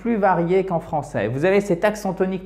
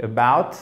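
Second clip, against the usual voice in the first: "about" with the first syllable swallowed, BOUT stressed; word stress; 3 semitones lower than usual. Shown as a demonstration. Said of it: "il faut bien faire l'accent tonique". (« about » fortement accentué sur la deuxième syllabe)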